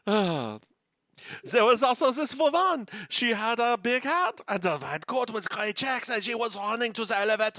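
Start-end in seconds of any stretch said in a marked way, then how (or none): 1.4-7.6 put-on high, theatrical French-accented storytelling. Host describes a woman running, high put-on French accent. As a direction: tell it in a mock French accent, pitched high. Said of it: "hurts my throat to keep doing that".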